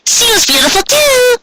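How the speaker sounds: high, bright, rising call; excited young pony calling out; bubbly Pinkie voice. Acted. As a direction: toss it back over her shoulder gleefully. Pinkie calls back about Granny.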